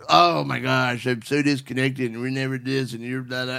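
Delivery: lightly acted whiny complaint; mock complaining. Host shows wives how not to approach husbands, complaining. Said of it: "not complaining or".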